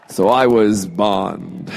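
slow, low mock Bond drawl. Fan panel, joking he was Bond, slow low mock spy voice. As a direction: drag it out like a lightly put-on 007. Playing Bond from club number.

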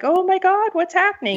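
high, alarmed panic; panicked. Retold or imitated. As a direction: sound suddenly panicked. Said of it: "Wallace sounded, uh, you know, like".

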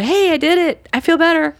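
high, loud, bright burst of relief; relieved happy news. Pretend. Person telling a supportive friend on the phone they did it, high excited relief. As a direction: announce it like a weight just lifted.

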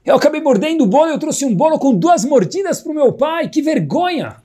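put-on high-pitched embarrassed child's voice, pouring out shame; ashamed boy. A narrator's reading. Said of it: "abaixa a cabeça com vergonha e fala".

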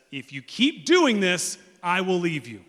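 loud, high, slow warning; threatening ultimatum. Acted. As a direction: lay down a forceful threat to walk out. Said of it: "Jesus is never the person who says".

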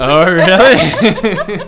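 amused drawn-out really; laughing surprise. Real, not acted. Surprised by egg mix-up.

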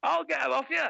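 hammed-up champagne-spraying champion; mock race winner. Spraying girlfriend with cola like a racer, triumphant. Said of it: "they go".

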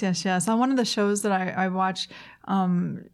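ordinary delivery

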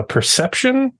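ordinary talk

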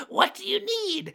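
very high gnome squeal after a giggle; giggly, sky-high gnome; committed gnome voice. Acted. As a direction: squeak it out, fully in character. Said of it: "I'm just committing to the bit".

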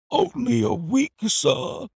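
high pleading cry of despair. A narrator's reading. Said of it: "he cried in a despairing voice".

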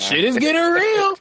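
high, loud excited cry; excited yelp. Real, not acted. Friend yelps at the pregnancy news, high and excited. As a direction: shoot the voice way up in delight.

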